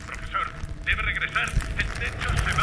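high, loud alarmed plea; urgent warning, frightened; panicked. Acted. Alarma por derrumbe. (aterrorizado y agudo)

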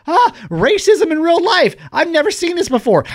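high, loud mock-amazed exclamation; mock stunned. Onlooker stunned by racism in real life, hammed-up shock. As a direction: gasp it out, hammed-up astonishment. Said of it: "I was so stunned".

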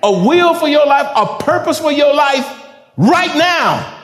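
loud, high, fervent sermon delivery; fiery preaching. Acted. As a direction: preach it with full fire. Preaching at full force.

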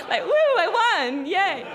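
loud, high, laughing fake cheer; mock celebration. Tourist pretends to celebrate her win, loud high laughing cheer. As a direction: ham up a fake victory whoop. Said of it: "I guess I was thinking".

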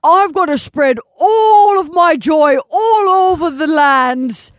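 loud, slow, high proclamation; Santa. Acted. Santa announces his mission to the co-host, hammed-up booming. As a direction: shout it grandly with stretched words.

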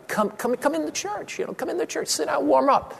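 high, soft coaxing invitation; gentle coaxing. Retold or imitated. Pastor inviting a cold lady into church, gentle coaxing. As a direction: coax them in softly, pitched well up.

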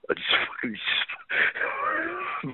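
words dissolve into crying; breaking into tears. Real, not acted. Brother on the phone breaking down, sobbing breathlessly. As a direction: voice cracks into a high breathless sob.

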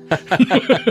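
short high burst of laughter. Real, not acted. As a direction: laugh out loud at the joke. Host laughs at the joke.

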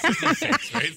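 laughing. Real, not acted. Teasing reassurance, repeated.

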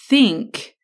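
"think" singled out with a rise in pitch; stress on think. Shown as a demonstration. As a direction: lean hard on "think". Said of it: "I want to emphasize that word think".

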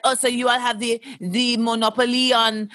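a light mock French accent; a sloppy French accent, off target. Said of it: "that's a French accent".

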